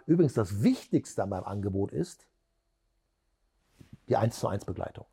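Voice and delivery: long pause after IST before the reveal; suspense pause. Shown as a demonstration. Trainer demonstrates pitching an offer, suspense pause after IST. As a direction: hang on IST, then deliver BEGLEITUNG calmly. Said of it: "du kannst eine Spannungspause machen".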